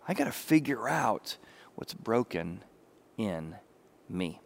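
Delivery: a hushed, voiceless confession; softly whispered self-reflection. Retold or imitated. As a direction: whisper it as a private admission. Chris Rock's quiet self-examination.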